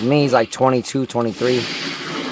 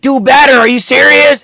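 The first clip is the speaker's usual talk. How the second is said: loud, high-pitched badgering; imitating coworkers yelling abuse; mock berating shout; 11 semitones higher, 6 dB louder than usual. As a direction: bellow it like an abusive coworker. Drunk coworkers berating him, acted.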